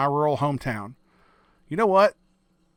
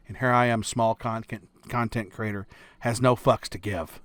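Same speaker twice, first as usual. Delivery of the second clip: usual speaking voice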